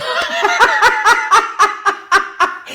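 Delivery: loud, high burst of laughter; big high laugh. Real, not acted. Laughing at his own dark joke, loud and high. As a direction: crack up at your own joke.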